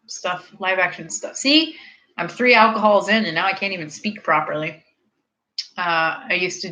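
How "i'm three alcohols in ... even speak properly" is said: ordinary talk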